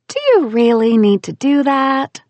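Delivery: syrupy fake sweetness; sweetly. To bossy Bobby, sweetly strained. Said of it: "as sweetly as I could".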